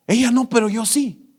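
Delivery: put-on a drunk man suddenly cutting in; imitating a drunk calling out; drunk man calls out.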